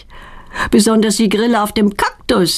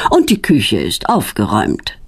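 proud; 10 semitones lower than usual. A narrator's reading. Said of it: "sagt er stolz".

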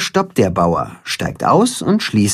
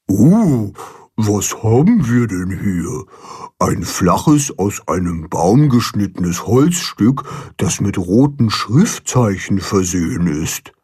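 Karpfen; 3 semitones lower than usual. A narrator's reading. Gelehrter Karpfen erklärt.

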